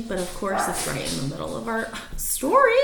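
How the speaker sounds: ordinary delivery, nothing marked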